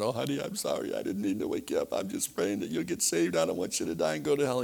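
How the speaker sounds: lightly voiced tearful wife; a hint of a pleading wife.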